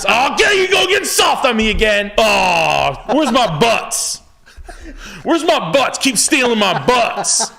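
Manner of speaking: loud, very high mock holler; wife impression. Husband imitating his wife teasing him, hollered high impression. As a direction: caricature a wife yelling with drawn-out words. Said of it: "That's her to me".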